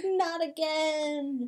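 slow, drawn-out not again; weary mock groan. Retelling a movie hero, slow mock weary groan. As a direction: sigh it out slowly. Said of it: "He's like".

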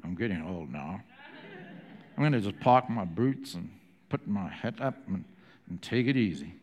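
Eastwood-style low drawl; low tough cowboy drawl. Retold or imitated. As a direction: play it like a laconic gunslinger. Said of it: "No, he wouldn't talk like that".

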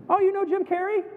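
high-pitched, mock-excited question; imitated starstruck acquaintance; eager questioner. Americans naively ask about famous Canadians.

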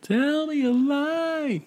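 high, slow sarcastic whine; sarcastic sing-song. Pretend. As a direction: stretch it out mockingly. Sarcastic high drawn-out line.

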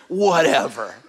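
mock unimpressed brush-off; dismissive. Weary parent hearing about heavenly worship, dismissive. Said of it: "And you're like".

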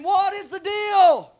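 high, loud, drawn-out cry to heaven; exasperated shout. Acted. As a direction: holler it slowly in exasperation. Moses acted crying out to heaven.

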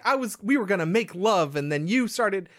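hammed-up lovers' quarrel; mock complaint. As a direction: act out an accusing girlfriend. Acted lovers' quarrel from film.

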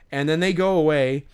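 ordinary talk, nothing marked

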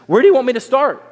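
loud, high-pitched comic question; mock eager demand. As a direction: burst out as if spoilt for choice. Mock eager reply to Jesus.

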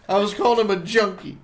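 ordinary talk, nothing marked

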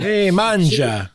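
slow, hammed-up Italian accent. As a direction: drawl it like an Italian nonna. Mimics Italian hostess.

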